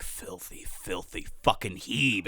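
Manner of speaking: slow, hushed mock-hateful mutter; low whispery venom, put on; muttered under breath. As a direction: imitate someone muttering with contempt, barely voiced. Nurse muttering slur under breath.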